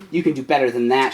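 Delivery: hissing. Acted. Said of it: "she hissed".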